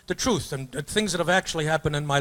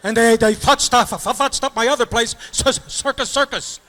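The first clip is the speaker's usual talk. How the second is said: stammering owner; 7 semitones higher, 3 dB louder than usual. Retold or imitated.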